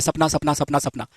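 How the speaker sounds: quiet repeated echo, trailing off; imitated echo. Shown as a demonstration. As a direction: mimic an echo coming back, dying away. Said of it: "तो उधर से आवाज आता है".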